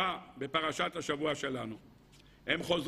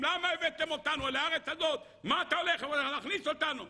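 crowd shouting; 9 semitones higher than usual. Retold or imitated. The people shouting at Moses, high mock outcry. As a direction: cry out as six hundred thousand protesters. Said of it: "צועקים".

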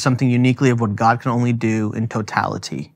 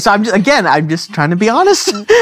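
laughing; 7 semitones higher, 3 dB louder, faster than usual. Real, not acted. Laughing while talking.

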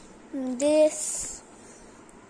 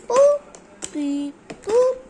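playful boops; 6 semitones higher, 7 dB louder than usual. Real, not acted. Playful noises placing lollipops.

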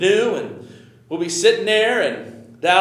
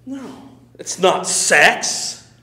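ordinary talk

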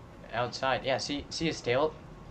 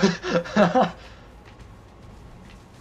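loud, high burst of laughter; panicked laugh; 8 semitones higher, 7 dB louder than usual. Real, not acted. Laughing as dino charges.

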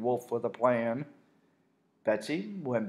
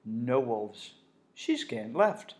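plain reading voice

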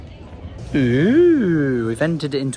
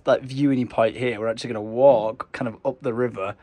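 normal talking voice

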